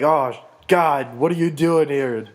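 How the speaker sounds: hammed-up shock at an unwelcome sight; mock alarm. Macbeth sees Banquo's ghost in his seat, mock alarmed outburst.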